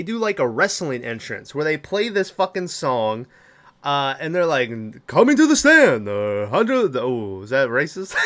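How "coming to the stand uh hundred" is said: mock Japanese accent, high and loud like a ring announcer; hammed-up Japanese announcer accent. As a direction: boom out the intro in a put-on Japanese accent. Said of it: "I started doing a Japanese voice".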